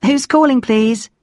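high, polite receptionist voice; secretary. A narrator's reading. Secretary answering a caller at Dad's office, politely high. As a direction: answer the office line in a singsong lift. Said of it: "I only got a secretary".

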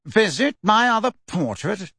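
a hint of a reedy old wizard; lightly voiced reedy portrait. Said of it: "said Phineas in a reedy voice".

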